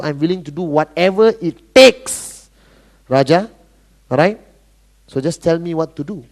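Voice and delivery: low-pitched, forceful commitment; acting out an all-in recruit; determined prospect. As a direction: build to a hard punch, still low. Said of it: "if somebody walks up to you and talk like that".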